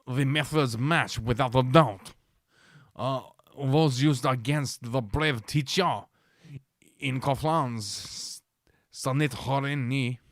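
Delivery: slow, mock French accent. Reading what the mayor went on to say, French accent. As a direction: quote it in a put-on French accent. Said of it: "I'll get my French accent going".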